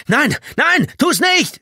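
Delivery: frantic high, loud shouts; screaming. A narrator's reading. Tommy running towards the man at the wire, screaming. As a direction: yell an urgent warning. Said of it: "schrie er".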